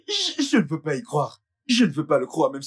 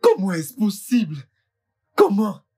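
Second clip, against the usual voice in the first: higher, louder disbelief; anguished outburst; 4 semitones higher, 4 dB louder, slower than usual. Acted. Husband confronts the doctor in anguish. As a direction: cry out in disbelief.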